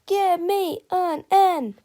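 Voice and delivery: loud, high, drawn-out cheerleader chant; cheer shout. Acted. Cheerleaders call to the crowd, loud drawn-out cheer. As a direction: belt it to the stands like a cheer. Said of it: "shouted the cheerleaders".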